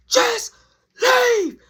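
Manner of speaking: high, loud, broken-up yell. Retold or imitated. Teller orders Jazz out at the door, yelling. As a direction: yell it word by word, exasperated. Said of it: "Just so I yell".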